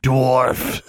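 high sneering creature voice. A narrator's reading. Creature insults the roommate on arrival, sneering. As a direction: sneer it as a nasty little beast. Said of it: "sneered Honey".

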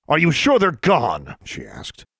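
posing as a man. A narrator's reading. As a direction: press for an answer in disguise. Disguised Alexandra presses the witch.

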